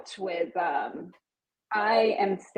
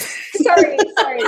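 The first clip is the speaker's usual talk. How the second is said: laughing; 7 semitones higher, 7 dB louder than usual. Real, not acted. Apologizing while laughing.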